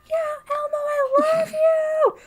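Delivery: put-on high, loud squealing kid; screaming child. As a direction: shriek it like a little kid in the crowd. Screaming kids at Elmo concert.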